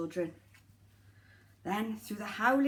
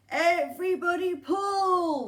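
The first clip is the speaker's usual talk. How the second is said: slow, loud call to pull; rally the reindeer; Santa urging; 5 semitones higher, 7 dB louder, slower than usual. Acted.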